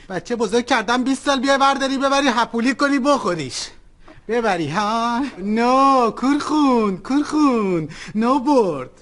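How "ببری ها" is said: ordinary talk, nothing marked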